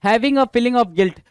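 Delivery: reading the definition out slowly; slow dictation. Shown as a demonstration. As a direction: read it slowly so students can note it down. (धीमी आवाज़ में परिभाषा पढ़ना)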